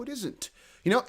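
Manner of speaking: normal talking voice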